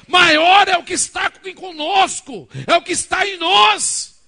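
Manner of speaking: loud, high, slow pulpit shouting; a pastor roaring to his congregation; fiery preaching. Real, not acted. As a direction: preach it with all your force against fear. Preaching at full force.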